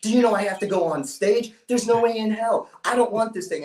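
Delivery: high-pitched, outraged mock scream; inner scream. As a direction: re-enact screaming in protest inside your head. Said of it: "I'm screaming".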